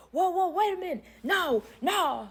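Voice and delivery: high-pitched imitation of frantic protest; hammed-up alarmed pleading; mock panic. Retold panicked husband protesting.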